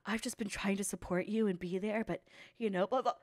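quiet, droning mock sincerity; mock soft speech. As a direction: parody his fake caring tone. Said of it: "gave me this long speech".

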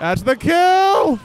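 loud, high, thrilled yell; excited shout. Real, not acted. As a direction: roar out the winning moment. Game-winning kill, both shout.

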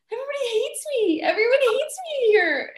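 high-pitched mock crying wail; tearful wailing. New performer crying to the dresser at intermission, mock tearful wail. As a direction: wail it, acting out a sobbing complaint. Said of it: "just, like, started crying".

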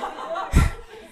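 loud open laugh; a big real laugh bursts out; burst of laughter. Real, not acted. Listener cracking up at the upspeak joke, loud laugh.

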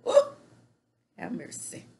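ordinary talk, nothing marked

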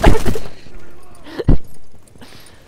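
high, loud squealing laughter; howling with laughter; shrieking laugh. Real, not acted. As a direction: lose it completely. Cracking up at the line.

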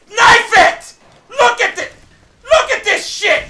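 very high, frustrated yelling; real high-pitched exasperation; frantic outrage. Real, not acted. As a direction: cry out frantically mid-fight. Player frantic at an opponent.

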